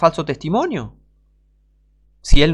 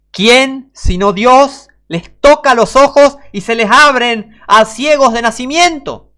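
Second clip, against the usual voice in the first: loud, higher, slow preacher's cry; fiery preaching; 8 semitones higher, 8 dB louder, slower than usual. Acted. Preacher challenging his listeners, loud and fervent. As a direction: thunder the question at the congregation.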